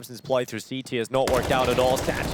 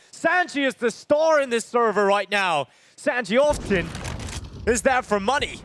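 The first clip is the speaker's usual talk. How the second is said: excited shouting; 7 semitones higher than usual. Real, not acted. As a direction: yell it like a huge play. Caster hyping a star play.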